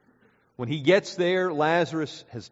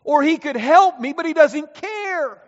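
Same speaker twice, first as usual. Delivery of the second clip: higher and much louder, ringing out; full-force preaching; 14 semitones higher, 12 dB louder than usual. Acted. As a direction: build the sermon to its climax. Climactic preaching.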